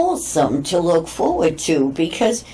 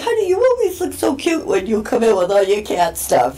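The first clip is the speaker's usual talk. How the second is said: put-on fond old man; 6 semitones higher than usual. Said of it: "one guy always says to me".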